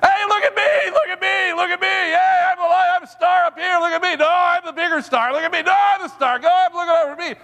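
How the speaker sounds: very high, shrill cartoon voices all crying look at me; screaming stars. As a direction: squeal for attention like show-off stars. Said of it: "all you heard was".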